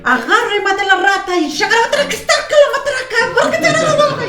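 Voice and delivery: high, loud, laughing babble; ecstatic tongues. Retold or imitated. Imitating the lady speaking in tongues, loud and high. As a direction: rattle it off in a frenzy.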